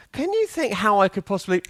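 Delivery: high-pitched mock plea for help; acted puzzled question. Developer going up to a colleague with a problem, high mock puzzlement. Acts out asking someone for help.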